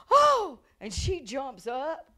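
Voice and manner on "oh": high loud amazed cry; joyful oh. Retold or imitated. Healed woman crying out in surprise. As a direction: burst out in astonished joy.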